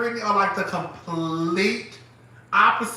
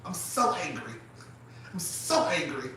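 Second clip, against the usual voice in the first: softer mock-angry impression; friend's restrained anger; 8 dB quieter than usual. Imitating how the friend sounds when pissed off, restrained. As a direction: mimic her polite fury, controlled and quiet. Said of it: "that's how you know she pissed off".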